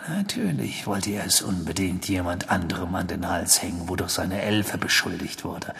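low, soft reasoning; Sirius, brooding. Acted. Sirius works out Crouch's motive, quietly brooding.

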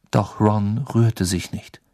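plain reading voice

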